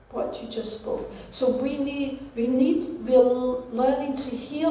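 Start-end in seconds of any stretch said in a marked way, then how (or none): 0.1-1.1 gentle whispered repeat of "What you just spoke". Acted. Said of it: "the Holy Spirit just says".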